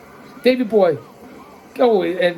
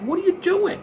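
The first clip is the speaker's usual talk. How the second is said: much higher, disbelieving cry; can't believe what he's watching; exasperated outburst; 11 semitones higher than usual. Real, not acted. Yells at wrestler not hooking leg.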